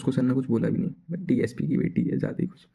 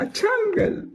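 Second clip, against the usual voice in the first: much higher, incredulous question; surprised; slower than usual. Retold or imitated. Boy surprised the uncle barely passed, high pitched. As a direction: act amazed at the uncle.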